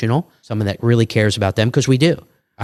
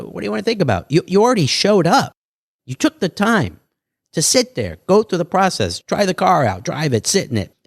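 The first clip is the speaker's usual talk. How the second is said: higher, pushing back on the buyer; incredulous challenge; 8 semitones higher than usual. Retold or imitated. Salesman questioning a buyer who wants to think, higher and incredulous. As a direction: needle the buyer in disbelief.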